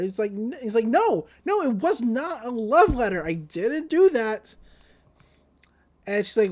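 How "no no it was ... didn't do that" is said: high-pitched insistent protest; emphatic denial. Retold or imitated. Boy denying he wrote a love letter, emphatically protesting. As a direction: act out flustered insistence.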